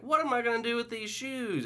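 put-on high bewildered old woman; old lady voice. Old resident asking staff, high puzzled voice. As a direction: ask like a puzzled grandma. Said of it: "she had moved on to, like".

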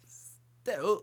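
high, odd Walken-style delivery; Christopher Walken impression. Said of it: "That's how Christopher Walken would say it".

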